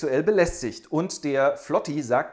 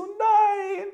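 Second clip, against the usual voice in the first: long, very high held cry of dismay; drawn-out shocked no. Acted. Rival baron hearing he lost the bride, long high shocked cry.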